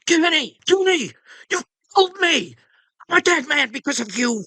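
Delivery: high-pitched, strained outburst; high, desperate drunken tirade; Roy's drunken shout. A narrator's reading. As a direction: yell it high, furious and accusing. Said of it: "and he shouted".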